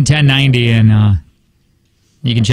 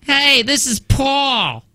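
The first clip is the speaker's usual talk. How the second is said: high, drawn-out mock Jersey voice; put-on New Jersey accent, pitched up; 8 semitones higher, 3 dB quieter, much slower than usual. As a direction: stretch it out like a cartoon Jersey guy. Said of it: "You can do a Jersey one".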